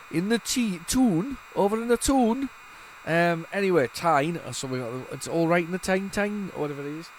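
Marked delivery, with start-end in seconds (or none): 0.1-2.5 Geordie. Pretend. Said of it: "Tynesoft, we get, we're here again".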